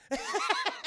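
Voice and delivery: sudden high cackle; real laugh. Real, not acted. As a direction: burst out laughing. Laughs at the scene.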